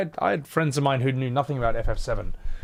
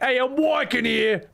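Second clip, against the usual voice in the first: "Hey, I'm working here." in a higher New York accent, "working" said "woikin"; 11 semitones higher than usual. Shown as a demonstration. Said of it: "you wanna do a New York accent".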